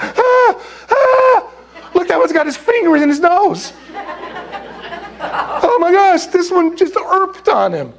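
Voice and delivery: high, stretched cries of mock alarm; horrified squeal with laughter around it; freaking out. Apostles watching kids climb on Jesus, hammed-up freak-out. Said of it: "they're freaking out".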